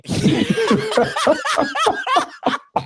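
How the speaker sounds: long, very high cackling laugh; real high wheezy laughing fit; high-pitched laugh. Real, not acted. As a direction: crack up helplessly at your own joke. Cracks up at own joke.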